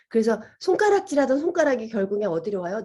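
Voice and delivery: ordinary talk, nothing marked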